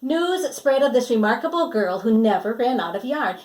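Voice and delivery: usual speaking voice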